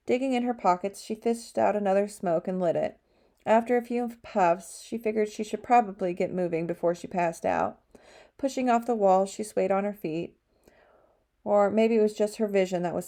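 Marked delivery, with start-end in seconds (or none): none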